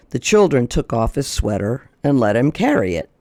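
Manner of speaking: ordinary delivery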